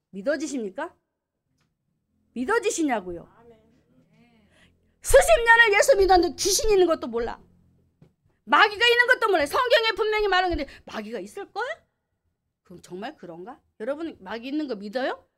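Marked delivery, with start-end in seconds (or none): 5.0-11.8 loud, high rebuke from the pulpit; preaching at full force; fiery preaching. Acted. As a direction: thunder at the congregation.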